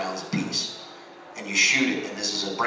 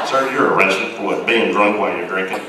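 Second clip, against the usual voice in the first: mock cop; 9 dB louder than usual. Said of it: "mock cop joke".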